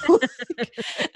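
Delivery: usual speaking voice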